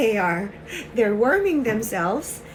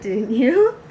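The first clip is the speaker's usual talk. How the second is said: ordinary delivery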